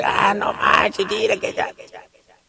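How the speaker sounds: hushed mock disapproval; mocking gossip. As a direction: mimic people tutting under their breath, fading to a whisper. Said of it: "cuando dice".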